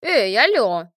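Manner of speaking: loud, indignant call; shouted protest. A narrator's reading. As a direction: shout to get their attention. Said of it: "крикнула Инга".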